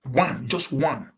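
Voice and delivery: ONE said slowly, each word weighted; slow emphatic one. Acted. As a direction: drag out "One, just one" for weight. Stressing one show makes millionaire.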